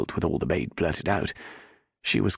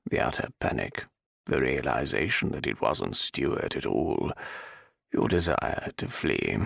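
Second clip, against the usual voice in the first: menacing villain; 7 semitones lower, 3 dB quieter than usual. A narrator's reading. Viscount menacing the cornered heroine.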